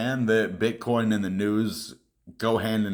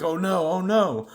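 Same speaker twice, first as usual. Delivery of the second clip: high, mocking "oh no" of a scared investor; mock panic; 7 semitones higher than usual. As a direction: imitate a panicking newbie, lightly. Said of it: "It'd be like".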